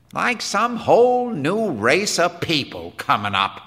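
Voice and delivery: high-pitched, emphatic wonderment; wondering. Acted. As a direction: marvel aloud at these strange newcomers. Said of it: "said Quinn wonderingly".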